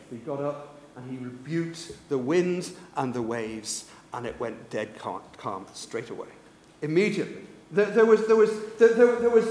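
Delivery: ordinary talk, nothing marked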